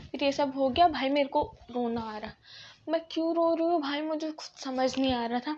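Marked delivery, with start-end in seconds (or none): none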